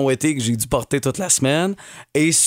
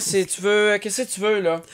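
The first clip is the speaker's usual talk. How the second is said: talking to a cat; 7 semitones higher than usual. Shown as a demonstration. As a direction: coo gently at the cat. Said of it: "Fais-moi une démonstration un peu".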